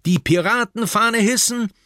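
hoch und laut kommandierend; befehlend. Acted. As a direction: wie ein kleiner Piratenkapitän befehlen. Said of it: "befiehlt Püppi". (Püppi gibt der Mannschaft einen Befehl, laut)

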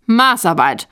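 loud, punched exclamation; calling out. A narrator's reading. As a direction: call it out loudly. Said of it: "rief Sprotte". (laut ausgerufen)

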